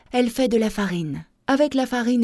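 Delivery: ordinary delivery, nothing marked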